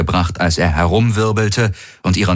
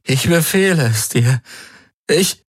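higher, cracking attempt at an order; strained command; 10 semitones higher than usual. A narrator's reading. In agony he tries to command the Helix, voice breaking. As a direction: order it, then choke off.

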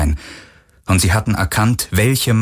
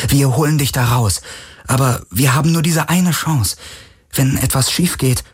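higher-pitched, earnest woman's character voice; urgent Kirai; 6 semitones higher than usual. Acted. Kirai promises Galen a rescue with one chance, urgent. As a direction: impress the risk on him, pitched up.

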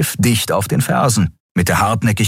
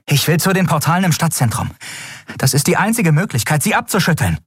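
higher, quick and urgent; a pilot focused mid-chase, talking fast; determined young pilot; 9 semitones higher, faster than usual. Acted. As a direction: Endriel explaining her escape route. Endriel explains her plan.

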